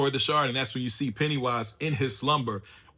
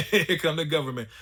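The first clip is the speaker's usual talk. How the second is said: higher-pitched, through real laughter; amused laughing; 4 semitones higher, 5 dB louder than usual. Real, not acted. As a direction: burst out laughing, then speak through it. Laughs, repeating the line in amusement.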